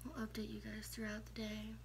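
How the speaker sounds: hushed, groggy morning voice; sleepy morning whisper. Real, not acted. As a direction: whisper it like you just woke up. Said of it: "I still got morning voice".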